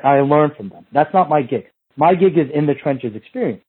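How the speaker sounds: usual speaking voice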